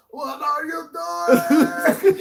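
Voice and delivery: high, loud, stretched-out shout; imitated yelling. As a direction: holler like an alarmed rescuer, slowly. Imitates rescuers yelling at them.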